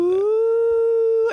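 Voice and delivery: high, smooth howl; howling dog. Retold or imitated. Imitating the dog Farley howling in his sleep, high smooth howl. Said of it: "He's all".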